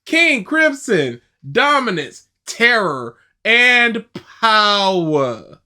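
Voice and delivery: grand, drawn-out reveal, last word stretched; dramatic title announcement. Acted. Host unveils the video's title to viewers, dramatically announced.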